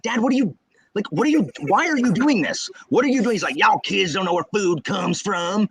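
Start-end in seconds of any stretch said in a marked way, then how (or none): 0.0-3.3 high-pitched mock exasperation; baffled questioning. Baffled child confronts corn-shucking dad. 3.6-5.7 high, drawling Southern dad; dad's Southern accent. Retold or imitated. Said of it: "they have strong Southern accents".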